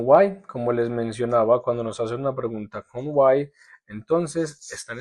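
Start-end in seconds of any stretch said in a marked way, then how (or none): none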